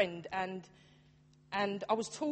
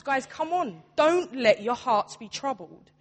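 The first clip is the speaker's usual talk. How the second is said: louder, drawn-out rallying plea; urging; 3 semitones higher, 6 dB louder, slower than usual. Acted. Preacher voicing Jesus rallying the disciples, urging. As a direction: urge them on with firm, stretched emphasis.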